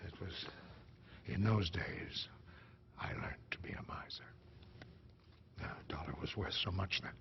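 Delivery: low, hushed, halting grief; quiet, pained recollection; sorrowful. Acted. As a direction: let it sink nearly to a whisper. Sorrowful memory of mother's fear.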